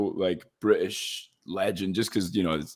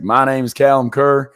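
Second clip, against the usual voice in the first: loud, higher-pitched Texan self-introduction; Texas accent; 6 semitones higher, 8 dB louder than usual. Retold or imitated. Actor slating at the end of an audition, Texas accent. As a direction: lay on a proud Texas accent. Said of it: "kept the Texas accent for the slate".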